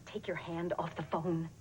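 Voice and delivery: low-pitched, restrained order; controlled warning. Acted. Mother on the phone warning a captor, controlled order. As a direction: keep the warning level and controlled.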